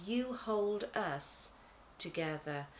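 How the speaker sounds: ordinary delivery, nothing marked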